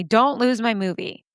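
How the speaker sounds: ordinary talk, nothing marked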